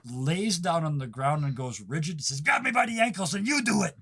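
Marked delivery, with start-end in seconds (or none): 2.4-4.0 high, urgent gnome demand; excited gnome command. Acted. Gnome lying rigid before the player, high urgent command. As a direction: order it in a raised, eager voice.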